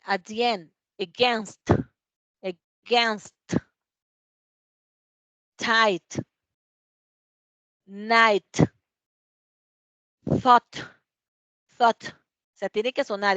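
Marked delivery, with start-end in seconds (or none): 1.0-12.0 "against", "tight", "night", "thought" each ending in an audible puffed t; careful model words "against", "tight", "night", "thought", final t blown out; sounded final T. Shown as a demonstration. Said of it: "esa T siempre tiene que sonar".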